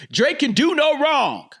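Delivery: high, loud exaggerated declaration; over-the-top fan insistence; mock proclamation. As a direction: proclaim it big and high-pitched. Mock proclamation about the favoured star.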